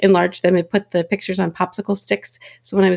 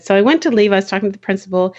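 ordinary delivery

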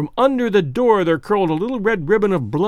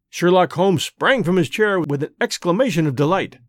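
usual speaking voice

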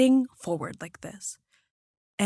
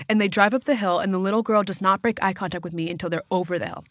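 ordinary talk

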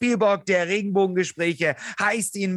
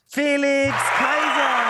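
drawn-out high boxing ring shout; ring announcer; 7 semitones higher, 3 dB louder, slower than usual. Acted. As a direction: bellow the name like a fight announcer. Introducing guest like ring announcer.

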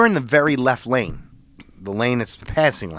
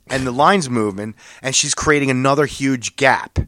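ordinary delivery

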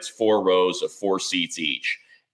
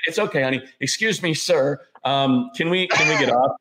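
lightly voiced mom; 6 semitones higher than usual. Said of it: "And she's like".

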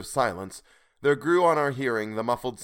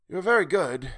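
high, louder thanks; exclaiming; 8 semitones higher, 6 dB louder than usual. A narrator's reading. Old man thanking Will for food, exclaiming. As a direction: exclaim it gratefully. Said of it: "exclaimed the voice".

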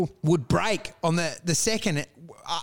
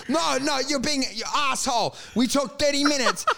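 high-pitched exasperated vendor imitation; mock outrage; 7 semitones higher than usual. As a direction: complain like a seller who's been strung along. Vendor outraged at dropping price.

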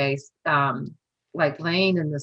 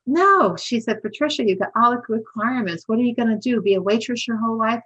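insistent friend; 6 semitones higher than usual. Retold or imitated. Friend pushing her to apply.

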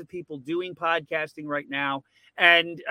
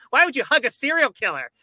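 mock outrage; 9 semitones higher, 7 dB louder than usual. Angry commenters voiced in a retold story.

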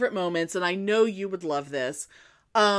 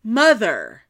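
louder, fed-up son cutting his mother off; exasperated son, louder; snapping at mother; 8 dB louder than usual. Retold or imitated. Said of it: "leans down and goes".

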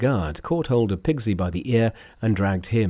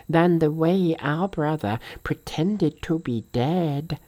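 slow, higher-pitched companion voice; Sandy's character voice; 6 semitones higher, slower than usual. A narrator's reading. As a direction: draw it out high, without extra volume. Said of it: "said Sandy".

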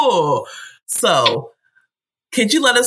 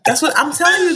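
ordinary talk

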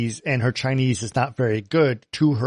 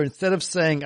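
ordinary delivery

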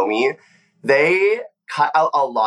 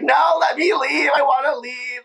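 pleading shout; 13 semitones higher, slower than usual. Retold or imitated. To the producer, refusing the lip sync: high pleading shout. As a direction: shout it out, begging to go.

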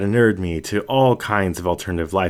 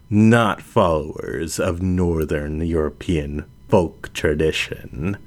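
measured lioness, amused and patient; composed lioness Helen; 4 semitones lower, slower than usual. Acted. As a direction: lower and slow, calm and unhurried. Helen's character voice.

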